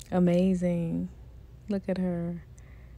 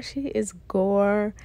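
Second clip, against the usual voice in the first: usual speaking voice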